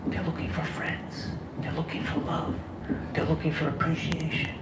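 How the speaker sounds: soft, nearly whispered, tender; hushed and heartfelt. Real, not acted. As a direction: let it fall to a hush, as if sharing a secret. Heartfelt point about lonely people.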